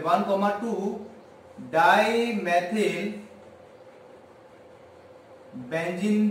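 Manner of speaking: "वन comma टू dimethyl benzene" spelled out slowly, word by word; a teacher dictating a chemical name at a crawl; slow dictation. Shown as a demonstration. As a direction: drag out "dimethyl" so students can write it down. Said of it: "one comma टू dimethyl benzene भी बोलते हैं".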